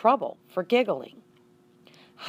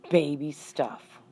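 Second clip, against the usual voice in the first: muttered; slower than usual. Acted. Bored boy mocking the mouse book, slow mutter. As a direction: drag it out under your breath, unimpressed. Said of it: "one of them muttered".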